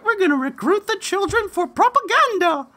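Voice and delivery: very high cartoon villain voice; mocking organizers recruiting children. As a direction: ham up a scheming propagandist. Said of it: "Hitler Youth in training".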